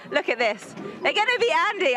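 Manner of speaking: ordinary delivery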